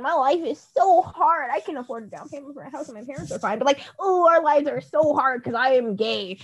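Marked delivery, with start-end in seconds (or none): 0.0-3.6 higher whiny privileged voice; mock whining. 4.0-6.4 slow, slightly higher sulky complaint; mocking complainers, slow and sulky; mock whining.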